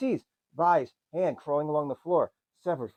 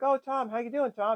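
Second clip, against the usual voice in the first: higher, lightly voiced sweet kid; little girl voice; 9 semitones higher than usual. Little sister greeting Tom, higher kid voice. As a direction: put on a chirpy kid voice.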